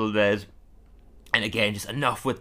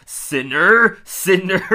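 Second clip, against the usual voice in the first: loud, raised, hammed-up cry; mock self-scourging cry; 7 semitones higher, 8 dB louder, much slower than usual. Chalmers whipping himself over a cross, loud mock cry. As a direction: call it out like a mock zealot punishing himself.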